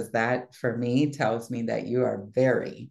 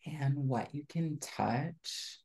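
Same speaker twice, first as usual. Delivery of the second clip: guided visualization. Acted. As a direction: lead them gently into the scene.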